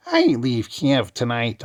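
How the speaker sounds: high, slow witchy cackle; cackling witch voice. Shown as a demonstration. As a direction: read it like a wicked witch. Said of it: "the cackling witch that she is".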